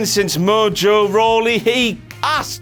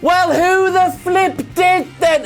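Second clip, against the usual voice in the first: outraged shout; 9 semitones higher, slower than usual. Acted. Outraged rhetorical question.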